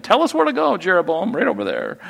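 sudden squeaky high jest; mock the king as a pointing dog; high playful teasing voice; mock pointer dog. Mocking king's frozen pointing arm.

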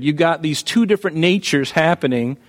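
ordinary delivery

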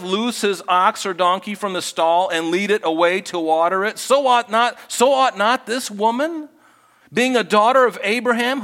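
high, loud, indignant rebuke; rebuking Jesus. Acted. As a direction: thunder it at the hypocrites. Jesus rebukes the synagogue ruler.

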